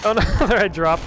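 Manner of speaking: loud, high laugh through words; laughing dismay. Real, not acted. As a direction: laugh it off loudly. Drops something, laughs.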